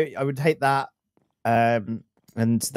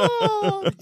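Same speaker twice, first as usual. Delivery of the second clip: excited gasp. Retold or imitated. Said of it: "They're there, they're like".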